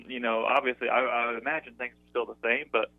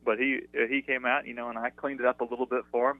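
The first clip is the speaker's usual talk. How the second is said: normal talking voice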